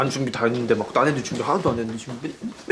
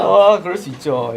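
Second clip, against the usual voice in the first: smug; 4 semitones higher, 7 dB louder, slower than usual. Pretend. Smug acceptance.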